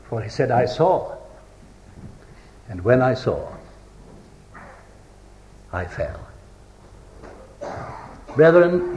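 low, quiet, with long pauses; hushed solemnity. Real, not acted. Preacher telling brethren of falling at Christ's feet, hushed. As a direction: drop low and let each phrase sink in.

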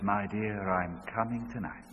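a hint of the hushed wife; soft, hushed words to husband. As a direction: quietly tell him. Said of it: "she says".

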